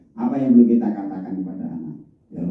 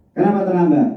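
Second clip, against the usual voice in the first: higher-pitched teacher's question; questioning a latecomer; 9 semitones higher, 3 dB louder than usual. Shown as a demonstration. Teacher to a pupil arriving late, questioning. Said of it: "Nadanya seperti itu ya?".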